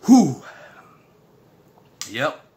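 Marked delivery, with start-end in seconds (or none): 0.1-0.4 sharp loud high whoosh of breath; loud startled exhale at the spice; hot pepper exhale. Real, not acted. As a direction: blow out the heat from your mouth. Eating very spicy noodles.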